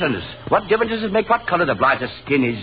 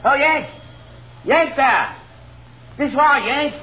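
calling out; 10 semitones higher, slower than usual. Acted. Calling the American over.

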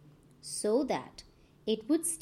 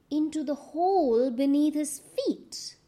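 ordinary delivery, nothing marked

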